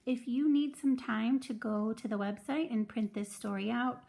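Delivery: ordinary talk, nothing marked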